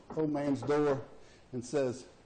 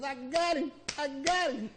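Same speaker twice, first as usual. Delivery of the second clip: gloating; 11 semitones higher than usual. Acted. Hunter gloating in joke.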